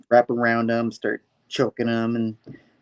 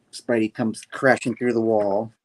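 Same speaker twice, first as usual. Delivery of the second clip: ordinary talk, nothing marked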